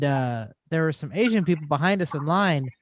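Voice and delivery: usual speaking voice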